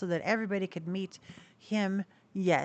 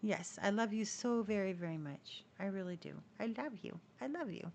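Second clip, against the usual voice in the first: much softer, near a whisper; soft loving murmur; 7 dB quieter than usual. Real, not acted. To her cockatiel after a kiss, soft tender murmur. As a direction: murmur sweet nothings to the bird.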